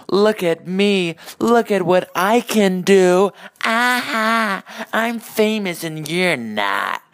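high, loud, drawn-out show-off; douchey Hollywood star. Acted. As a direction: flaunt your fame in a slow, high, mocking voice. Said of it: "a douchey fucking Hollywood star".

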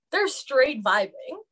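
relaxed, teasing drawl; slow playful drawl. Real, not acted. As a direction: stretch it out, amused. Playful boast about family.

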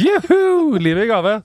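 very high, loud mock jubilation; mock cheer. Friend mocking his joy at strep, shrill cheer. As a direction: cheer it like the happiest patient alive. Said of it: "Verdens lykkeligste streptokokkpasient".

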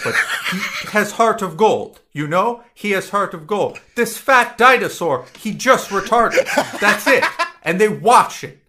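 cracking up while explaining Homer; laughing. Real, not acted. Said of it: "laughing mock explanation".

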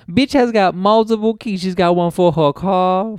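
loud, high hammed-up bragging voice; high mock brag. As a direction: crow about it to the shelter crowd. Mock bragging homeless guy.

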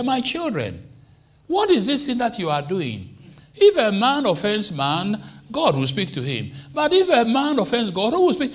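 a hint of an old priest chiding his sons; lightly voiced Eli. Eli chiding his sons, lightly voiced. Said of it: "I say".

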